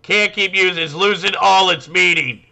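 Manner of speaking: high, loud mock whiny protester. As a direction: whine it like an aggrieved activist. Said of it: "And it's like".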